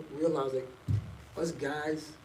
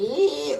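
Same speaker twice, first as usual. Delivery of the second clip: mock dance yelp; 11 semitones higher, 7 dB louder than usual. Mock dance-floor exclamation.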